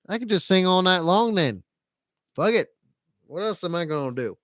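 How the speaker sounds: ordinary talk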